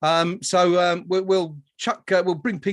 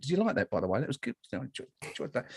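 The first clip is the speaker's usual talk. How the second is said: much softer, lower voice; quiet aside; 5 semitones lower, 9 dB quieter, faster than usual. Real, not acted. Host asks colleagues about his flourish, quiet low aside. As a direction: drop out of the announcement into a private check.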